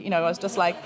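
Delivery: ordinary delivery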